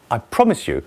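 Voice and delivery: normal talking voice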